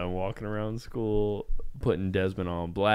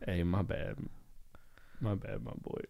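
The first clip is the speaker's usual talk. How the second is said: retold sheepish apology; 2 semitones lower, 13 dB quieter than usual. Telling his friend my bad, sheepish and soft. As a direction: own up quietly, voice fading.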